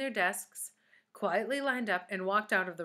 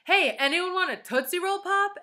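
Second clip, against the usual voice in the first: gleeful shout; 12 semitones higher, 9 dB louder than usual. A narrator's reading. Myron showing off.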